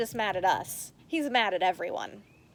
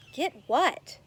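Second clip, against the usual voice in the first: plain reading voice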